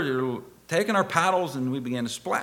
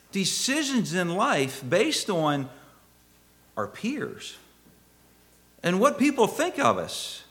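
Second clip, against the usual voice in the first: raised, emphatic preacher's voice; preaching; 10 semitones higher, 5 dB louder than usual. Real, not acted. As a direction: drive the point home to the congregation, pitched high. Preaches with rising force.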